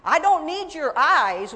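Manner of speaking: loud, slowed indignant retort; retold indignant retort. Speaker retorting to God in her memory, indignant and loud. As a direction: push back at God, loud. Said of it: "I said".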